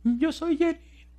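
very high, drawn-out mock shout; slow falsetto call, held back in volume; imitated cry for help. Buried woman calls out her name, imitated high cry. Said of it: "Y gritaba".

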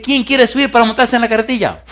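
high-pitched call for volunteers; performer's invitation, pitched well up; acted showman. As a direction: imitate a daredevil challenging the crowd. Acts tightrope walker inviting volunteers.

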